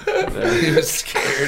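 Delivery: mock scared voice. Mocking the cracked voice.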